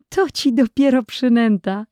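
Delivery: wyżej, z rozbawionym zdziwieniem; zawołaj wesoło, jakbyś nie mogła uwierzyć; wesoły okrzyk dziewczynki. A narrator's reading. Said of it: "zawołała Irenka". (Irenka woła do brata, rozbawiona i wyżej)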